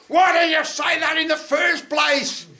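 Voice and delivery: loud, exasperated shout; fed-up shout at the yokel. Retold or imitated. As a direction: let your frustration burst out.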